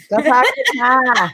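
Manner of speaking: light mock-Boston accent; imitated Boston accent. Streamer wants him told off, mock Boston accent. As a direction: play at Boston, dropping the Rs. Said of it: "I don't want to insult your accent".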